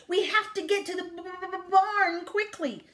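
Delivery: high, slow stutter on the b; bleating sheep. A narrator's reading. Sheep hurrying Duck to the barn, bleating. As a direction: stammer it like a worried sheep. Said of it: "the sheep bleated".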